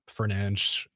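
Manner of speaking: Fernanch with a "ch" ending; Portuguese pronunciation. Real, not acted. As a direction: let Fernanch slip out the Portuguese way. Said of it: "I did it again".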